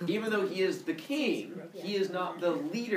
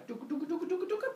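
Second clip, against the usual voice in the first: growing sound effect; much faster than usual. Retold or imitated. Siblings grow to normal size, high imitated effect. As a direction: patter it out like a cartoon effect.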